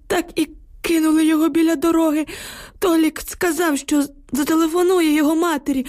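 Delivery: an old neighbour gasping out bad news; frightened old villager. A narrator's reading. Old neighbour tells the women about the bridge, anxious. Old man Yukhym voiced.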